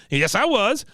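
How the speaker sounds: high-pitched stubborn comeback; mock indignant insistence. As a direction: squeeze the retort way up high. Cotton insisting against Peggy's denial.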